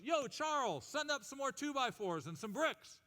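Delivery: high calling yell up to a worker; hollering foreman on a building site. Acted. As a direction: shout up to Charles from the tower. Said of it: "he yells, he goes".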